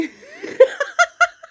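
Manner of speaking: loud, very high laughter; high cackling laugh. Real, not acted. Laughing at own eye-rolling demonstration, high cackle.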